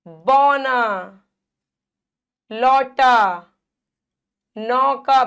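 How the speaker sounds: बौना, लौटा, नौका with long wide औ; slow, loud word demo of बौना, लौटा, नौका; open-mouthed vowel. Shown as a demonstration. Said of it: "बड़ा मुंह".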